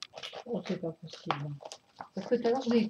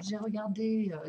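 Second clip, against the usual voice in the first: normal talking voice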